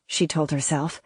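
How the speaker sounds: ordinary delivery, nothing marked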